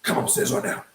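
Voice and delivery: unvoiced, soft, stern; dad hissing an order, soft and unvoiced. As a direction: threaten in a low hiss. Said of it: "He's like".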